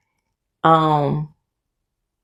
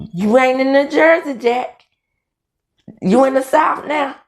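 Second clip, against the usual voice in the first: higher-pitched quoted taunt; Glenn; 6 semitones higher than usual. Retold or imitated. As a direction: lightly imitate a Southern put-down. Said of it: "you could hear Glenn saying".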